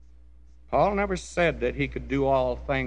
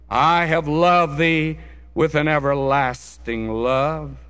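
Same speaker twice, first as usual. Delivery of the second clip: ordinary talk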